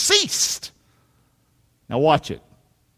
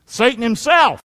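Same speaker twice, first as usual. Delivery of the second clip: usual speaking voice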